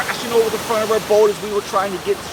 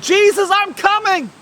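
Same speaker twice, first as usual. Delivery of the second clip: high, excited shout; eager call; 8 semitones higher, 4 dB louder than usual. Acted. Fisherman answering Jesus from the boat, eager shout. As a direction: call back at once, pitched high.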